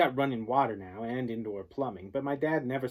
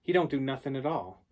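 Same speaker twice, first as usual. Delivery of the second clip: ordinary delivery, nothing marked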